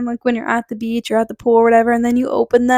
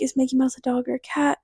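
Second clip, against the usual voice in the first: quick, softer inner voice; thinking to herself; 7 dB quieter, faster than usual. Retold or imitated. Puzzling over the dad's question in her head, thinking to herself. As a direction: run it over under your breath, quickly. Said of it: "So I'm thinking".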